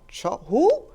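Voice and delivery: sleepy man not placing the name; confused Harold. Retold or imitated. Harold woken at three thirty, puzzled.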